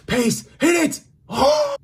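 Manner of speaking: building to a loud high yell; excited shouting. Real, not acted. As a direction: rise to a scream on the shot. Attack builds to shot.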